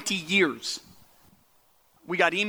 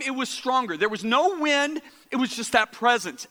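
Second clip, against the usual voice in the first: ordinary delivery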